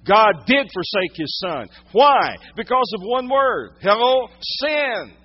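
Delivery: high, loud, impassioned sermon; pressing the point home from the pulpit; full-force preaching. Real, not acted.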